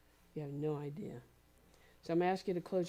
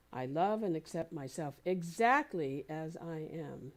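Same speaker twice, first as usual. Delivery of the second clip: slow, punching "exactly"; stress on EXACTLY; slower than usual. Shown as a demonstration. Speaker reads the affirmation to listeners, stressing "exactly" hard. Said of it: "I'm gonna emphasize the different words".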